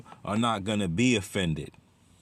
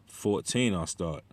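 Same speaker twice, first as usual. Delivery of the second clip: normal talking voice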